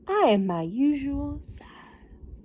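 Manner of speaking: slow, breathy whisper of the line. Acted. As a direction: whisper it slowly like a secret. Harold repeats line.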